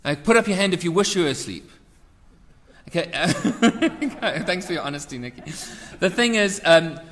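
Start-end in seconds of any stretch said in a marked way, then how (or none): 3.3-4.0 quick amused laugh up high; short laugh. Real, not acted. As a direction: burst out laughing briefly. Laughs at congregation's raised hands.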